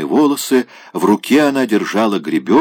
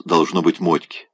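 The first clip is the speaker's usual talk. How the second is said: ordinary delivery, nothing marked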